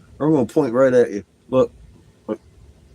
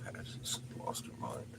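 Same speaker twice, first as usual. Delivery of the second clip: whispered remark; 10 semitones lower, 24 dB quieter than usual. Real, not acted. Whispered remark about dog.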